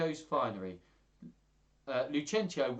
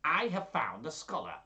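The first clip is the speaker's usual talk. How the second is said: raised, put-on suitor voice; old-story suitor making a proclamation; Gremio; 5 semitones higher than usual. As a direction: announce it in a higher character voice. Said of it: "Gremio in his third voice of the story".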